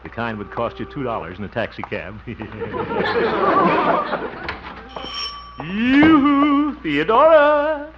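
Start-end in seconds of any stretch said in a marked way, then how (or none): 2.2-5.5 high, drawn-out giggle; a long, gleeful giggle; trademark giggle. Acted. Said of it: "his trademark giggle". 5.6-7.9 high, loud, drawn-out call. Acted. Calling out to Theodora.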